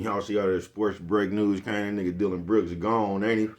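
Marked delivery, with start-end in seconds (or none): none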